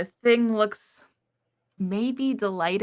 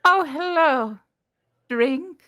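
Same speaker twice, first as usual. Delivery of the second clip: NPC greeting repeated; 7 semitones higher, 5 dB louder, slower than usual. Acted. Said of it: "What did they say?".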